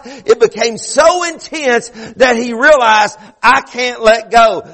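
high, driving sermon delivery; fervent preaching. Real, not acted. Preacher urging the congregation about Jacob wrestling God, fervent preaching. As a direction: preach it hard, stretching the key words.

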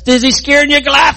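jeering taunt. Retold or imitated. Philistines jeering at Goliath.

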